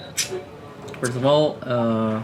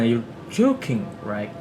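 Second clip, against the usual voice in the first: slow, surprised swoop on "joking"; slower than usual. Shown as a demonstration. Student demonstrating an expression of surprise, surprised. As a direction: stretch "joking" in disbelief. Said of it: "And something like that".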